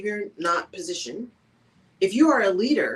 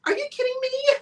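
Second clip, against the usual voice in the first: much higher, put-on "are you kidding me"; playful girlfriend-style disbelief; mock disbelief. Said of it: "Sometimes we'll say".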